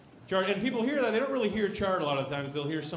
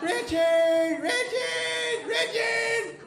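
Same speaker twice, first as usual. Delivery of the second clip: shouting a name higher each time; escalating roll-call shout; repeated shouting; 6 dB louder, much slower than usual. Retold or imitated. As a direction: yell for the kid who won't answer. Teacher calling louder and higher.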